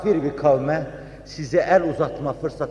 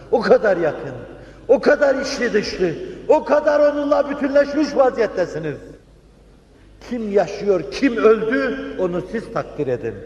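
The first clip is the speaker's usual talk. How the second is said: building steadily higher in pitch; fervent preaching; 7 semitones higher than usual. Real, not acted. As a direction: climb with each phrase to an impassioned peak. (giderek yükselen coşkulu vaaz)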